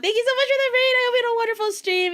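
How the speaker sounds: high, bright rapid thank-you; cute anime streamer. Real, not acted. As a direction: gush thanks to the raiders. Second raid thanks.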